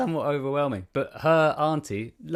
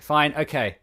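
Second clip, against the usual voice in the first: louder, higher fed-up acceptance; exasperated giving in; 4 semitones higher, 6 dB louder, slower than usual. Retold or imitated. Guest to girlfriend's auntie pushing falafel, exasperated giving in. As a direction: give in with an exasperated burst. Gives in to repeated offers.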